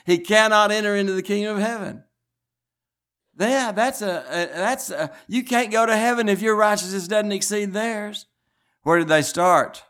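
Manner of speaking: high, loud preacher's cry; fiery preaching. Acted. Preacher driving scripture home to the church, ringing out loud. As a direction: preach it out to the whole congregation.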